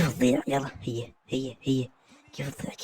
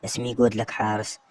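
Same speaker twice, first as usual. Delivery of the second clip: usual speaking voice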